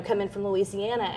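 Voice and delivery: ordinary delivery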